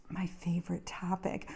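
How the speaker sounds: soft, whispered side comment; hushed wry aside. Real, not acted. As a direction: drop to a near-whisper.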